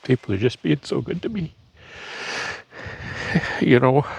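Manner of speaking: fighting back tears over kind people; choked up. Real, not acted. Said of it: "I'm sorry, I get emotional".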